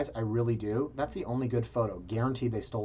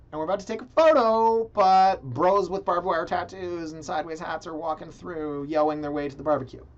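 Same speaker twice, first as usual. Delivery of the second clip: mock indignant storytelling; 4 semitones higher, 4 dB louder than usual. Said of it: "and I said".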